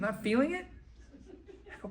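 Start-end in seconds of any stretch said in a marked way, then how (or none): 0.0-0.7 high, rising disbelief; incredulous question. Acted. Stung man querying his partner's excuse, incredulous. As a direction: question it with the pitch climbing in disbelief. Throws partner's words back again.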